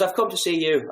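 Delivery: a touch of the Irish rep's voice; Irish accent. Retold or imitated. Irish rep telling Chris why he came, light mock Irish accent. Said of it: "the Irish guy whose accent I did so poorly".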